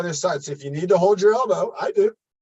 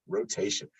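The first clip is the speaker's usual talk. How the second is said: whispered echo; 7 semitones lower, 8 dB quieter than usual. Shown as a demonstration. Coach echoing rotation to the class in a whisper. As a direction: repeat the word rotation as a soft breathy whisper. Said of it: "And then also rotation".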